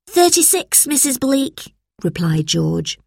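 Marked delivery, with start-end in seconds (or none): 0.1-1.7 put-on high-pitched boy's answer; obedient schoolboy. A narrator's reading. George answers in the test, high obedient voice. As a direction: recite it in a high child's voice.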